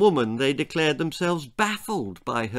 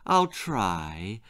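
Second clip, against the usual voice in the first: trembling reply; 6 semitones lower than usual. A narrator's reading. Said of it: "Lorelei Lee would tremble".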